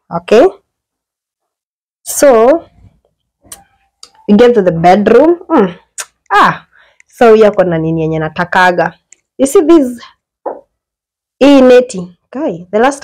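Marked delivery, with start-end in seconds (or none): none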